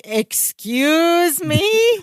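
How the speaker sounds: high, drawn-out rising question; indignant disbelief. Retold or imitated. Podcaster as Jennifer hearing Larry's claim, indignant disbelief. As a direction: stretch it upward in mock offence. Acted offended reply to condescending remark.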